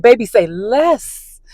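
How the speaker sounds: slow, drawn out, delighted; eager relief. Retold or imitated. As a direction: stretch it out happily. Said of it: "I was like".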